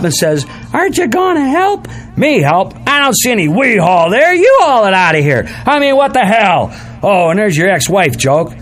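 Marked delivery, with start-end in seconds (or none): 0.7-1.9 mimicking the wife. Wife's line in U-Haul story. 2.2-6.7 indignant retort. Retold or imitated. His retort to wife, acted.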